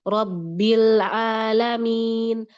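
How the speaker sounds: Robbil alamin very slow, the BB pressed hard with the lips; strong lips. Shown as a demonstration. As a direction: clamp the lips on Robbil and drag it out. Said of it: "kuat bibirnya".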